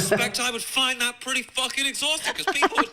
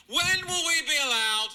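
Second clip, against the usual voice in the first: higher, slow, sneering mock plea; mocking whine; 7 semitones higher, slower than usual. Comedian mocking TV guest, in a high sneering whine. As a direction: whine it like a pampered celebrity. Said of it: "and go".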